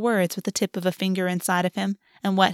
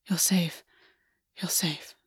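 hurried whisper, almost no voice; rushed whisper; 2 semitones lower, 7 dB quieter, slower than usual. A narrator's reading. August soothing Gwen, hushed and rushed whisper. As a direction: breathe the words out quickly, soothing. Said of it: "but the words were rushed".

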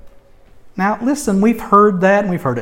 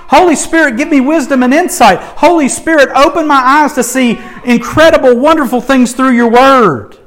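eager prayer; 5 semitones higher, 3 dB louder than usual. Retold or imitated. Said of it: "I'm running to it".

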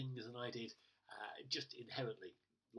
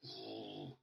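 very low grumbling groan; mock grumpy growl. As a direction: growl at a driver cutting in. Grumbles at driver cutting in.

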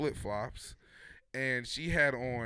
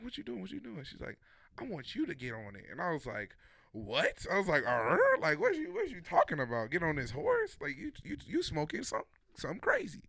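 high disbelieving voice, "what you talking about"; high, squeaky mock disbelief; incredulous; 6 semitones higher than usual. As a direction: pitch way up in mock outrage. Quoting own disbelief, step stool.

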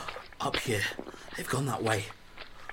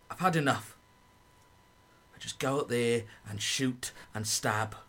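ordinary talk, nothing marked

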